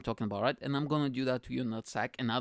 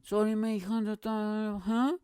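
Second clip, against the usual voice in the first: high, garbled Scouse imitation; mock an unintelligible Scouser, pitched up; Scouse accent; 6 semitones higher, slower than usual. Passenger repeating himself to the storyteller, in high mock Scouse. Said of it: "So he goes again".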